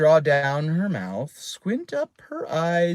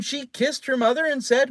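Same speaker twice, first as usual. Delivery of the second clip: ordinary delivery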